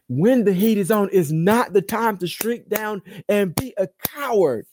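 high, loud pulpit declaration; emphatic preaching. Real, not acted. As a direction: drive it home to the whole congregation. Preached restatement of the same line.

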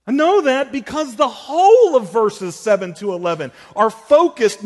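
high, loud, climbing sermon peak; preacher at full fervour; impassioned preaching. Acted. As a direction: drive the point home with rising force.

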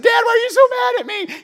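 put-on the little boy pleading with Dad; whiny kid. Son asks his angry pastor father, high and childlike.

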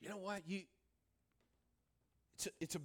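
ordinary delivery

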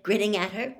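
ordinary delivery, nothing marked

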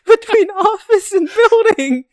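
high, slow words squeezed through laughter; laughing hard. Real, not acted. Teasing co-host over the misread, laughing hard. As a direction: crack up while getting the words out.

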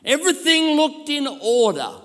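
high, ringing Bible reading; fervent scripture preaching. Real, not acted. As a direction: proclaim the verse dramatically to the crowd. Reads scripture at full force.

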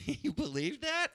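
drawn out, pitched high and slow; a slow, high, smug retort; slow high mock comeback. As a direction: stretch it like a smug punchline. Retelling his own comeback.

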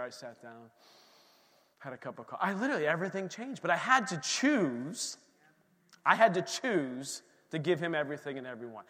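ordinary talk

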